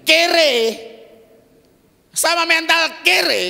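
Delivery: high, loud stretched kere; loud emphatic kere. Real, not acted. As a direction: shout kere to drive it home. Preacher shouting contrast words. (kere diteriakkan keras dan tinggi)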